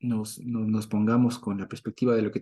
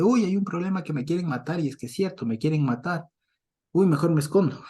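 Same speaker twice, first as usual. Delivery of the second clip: mock fright; 6 semitones higher, 3 dB louder than usual. Mock fearful coward voice.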